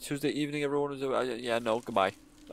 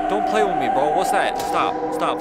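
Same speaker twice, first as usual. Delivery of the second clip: panicked pleading; 4 semitones higher, 7 dB louder than usual. Real, not acted. Panicking at a horror game.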